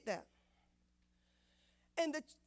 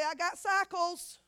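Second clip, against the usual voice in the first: congregation call; 4 semitones higher, 5 dB louder, slower than usual. Shown as a demonstration. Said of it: "Look at somebody and say".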